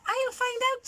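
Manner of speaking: a plucky little ladybird promising; ladybird's voice. Acted. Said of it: "said the ladybird".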